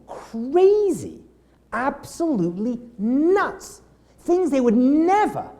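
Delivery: slow, drawn-out exaggeration; a touch of mock disbelief; mock crazed. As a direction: stretch out the craziness. Mocking crazed drivers.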